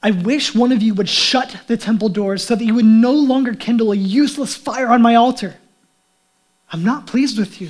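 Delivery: loud, emphatic scripture reading; forceful divine rebuke. Acted. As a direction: proclaim it as an exasperated God. Reads God's angry rebuke from scripture.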